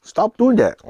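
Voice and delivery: high, loud mock telling-off; mock scolding. Shown as a demonstration. Showing how they scold their kids, mock scolding. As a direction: playfully scold a child. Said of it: "we go like this".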